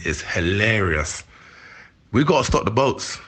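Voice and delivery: ordinary talk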